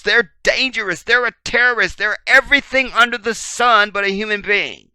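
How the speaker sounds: loud, high outraged rant; indignant accusation. Acted. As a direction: imitate a furious accuser piling up charges. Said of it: "What do you think this devil would say?".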